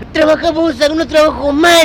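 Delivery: mock furious shout at the boss; retold angry outburst. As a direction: throw the job back at the boss. Quitting in a rage.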